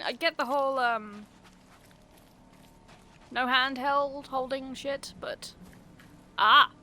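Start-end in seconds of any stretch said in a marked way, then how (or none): 6.4-6.7 startled yelp. Real, not acted. Sudden startle in game.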